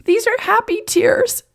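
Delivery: very high, overcome voice; laughing and crying. A narrator's reading. Emma reassuring Jason about her tears, tearfully happy. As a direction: squeak it out through happy tears. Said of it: "started laughing and crying at the same time".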